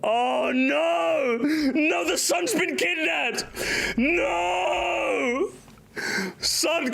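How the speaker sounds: high mock wail, crying out; hammed-up despair. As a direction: cry out like a father who lost his son. Mock grief over kidnapped son.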